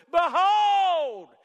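booming, high, drawn-out shout; emphatic shout. Acted. Preacher acting John announcing Jesus, shouting. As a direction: shout it out to make everyone look. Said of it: "It's like he was shouting".

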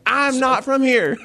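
very high, drawn-out South Carolina twang; thick South Carolina accent. Retold or imitated. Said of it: "the accent saved your life".

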